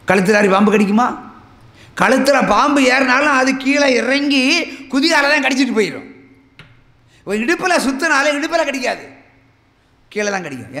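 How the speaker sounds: high, loud pulpit preaching; fiery preaching. Acted. Preacher to congregation about the serpent's bite, fiery preaching. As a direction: drive the point home to the congregation.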